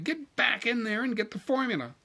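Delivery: high, bossy order; indignant demand. A narrator's reading. Wife sending husband back to his formula, indignant demand. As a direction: order them back to work, pitched high. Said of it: "she demanded".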